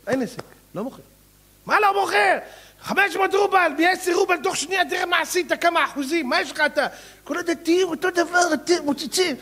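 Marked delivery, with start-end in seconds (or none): 1.7-9.4 high, loud exasperated haggling; indignant outburst. Acted. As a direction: push him hard. Angry buyer haggling quote.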